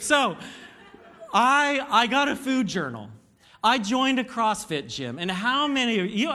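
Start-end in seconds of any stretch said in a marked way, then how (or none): 0.0-2.7 loud, high, laughing through the words. Real, not acted. Pastor resuming his story after the tummy joke, laughing. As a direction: try to carry on while cracking up.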